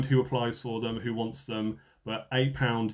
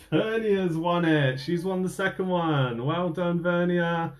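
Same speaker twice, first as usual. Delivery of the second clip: bright, raised announcing voice; excited; 6 semitones higher, slower than usual. Real, not acted. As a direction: cheer the winner's name out.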